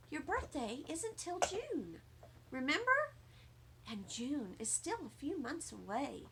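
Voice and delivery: put-on calm a child down; soft, patient mother; gentle mother.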